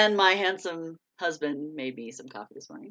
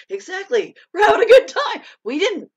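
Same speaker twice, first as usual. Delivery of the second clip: much higher, louder, laughing through the words; laughing shout; 12 semitones higher, 7 dB louder than usual. Retold or imitated. Agreeing with Sylvia while laughing hard, high and loud. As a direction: cry it out between laughs. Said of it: "And I'm like".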